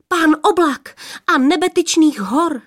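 eager sister gasps it out; blurted, breath catching. Acted. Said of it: "A třetí vyhrkla, až se jí zajík hlas". (vyhrkne vzrušeně, až se jí zajíkne hlas)

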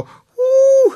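piercing high squeal of fright. Acted. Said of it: "křičelo". (pronikavé vysoké zakvičení)

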